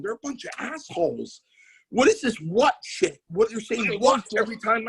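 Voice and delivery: high-pitched, mock-indignant rant; retold fuming complaint, pitch jumping up. Wrestler back in the dressing room griping about the crowd, mock fuming. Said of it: "I went back fuming".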